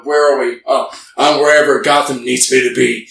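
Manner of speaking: usual speaking voice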